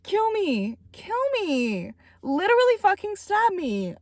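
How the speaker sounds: high, wailing fangirl despair; hammed-up agony. Real, not acted. Vlogger floored by the narrator's delivery, hammed-up agony. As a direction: plead melodramatically, pitch swooping down on each phrase.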